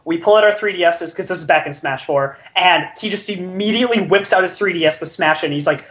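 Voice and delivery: ordinary talk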